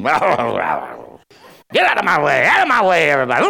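hammed-up slow monster growl; grouchy and mean. Grouch waking up and clearing everyone away, exaggerated mean growl. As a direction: growl it out long and loud like a morning grouch. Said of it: "wake up all grouchy and mean".

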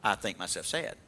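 lightly mocking gloom; mock sad. Shown as a demonstration. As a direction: droop into mock sadness.

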